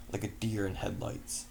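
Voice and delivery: usual speaking voice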